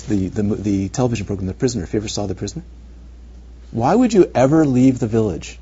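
ordinary talk

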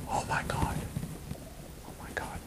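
whispered, horrified disbelief; shocked whisper. Retold or imitated. Woman stops before hitting her partner, whispers in horror. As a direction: breathe it out, aghast at yourself.

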